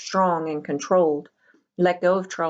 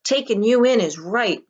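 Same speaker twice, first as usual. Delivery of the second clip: sarcastic retort; 5 semitones higher, 5 dB louder than usual. A narrator's reading. Meg retorts.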